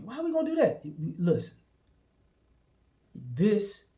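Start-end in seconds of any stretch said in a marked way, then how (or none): 0.0-0.8 high, puzzled question from an underling; questioning follower. Retold or imitated. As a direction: push back like a doubtful follower.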